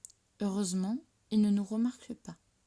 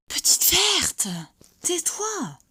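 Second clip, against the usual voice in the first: usual speaking voice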